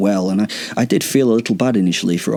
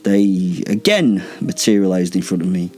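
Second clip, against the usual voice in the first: usual speaking voice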